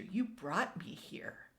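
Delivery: breathy whispering creature; whispered creature. A narrator's reading. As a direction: whisper it like a secret. Creature introduces itself quietly.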